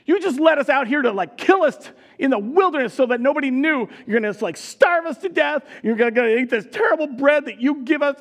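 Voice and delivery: loud, high accusing rant; accusing shout. Retold or imitated. Israelites accusing God of leaving them to die, loud high rant. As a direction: hurl the blame at God. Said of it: "they start accusing God".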